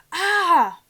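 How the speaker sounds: piercing loud, high scream; sudden agonised scream; scream of pain. Acted. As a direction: let out a burnt scream. Quirrell burnt by Harry's touch.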